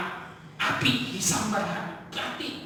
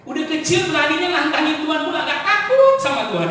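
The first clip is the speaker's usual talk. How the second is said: louder and higher, building outrage; indignant preaching; 6 semitones higher, 9 dB louder than usual. Shown as a demonstration. Preacher scolds people for not fearing God, loudly. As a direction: hammer the Udah kecil home. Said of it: "loud repeat after quiet take".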